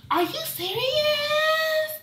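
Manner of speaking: very high, drawn-out disbelief; slow, high-pitched mock amazement; incredulous shriek. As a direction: imitate an astonished lady squealing. Imitating the astonished lady.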